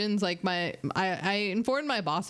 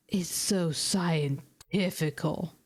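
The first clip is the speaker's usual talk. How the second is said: put-on slow drunk voice; 4 semitones lower, much slower than usual. Drunk customer's remark quoted.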